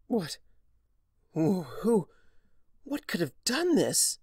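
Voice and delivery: high, stammering panicked questions; frantic as the horror sinks in; horrified stammer. Acted. Starlight horrified at ruins.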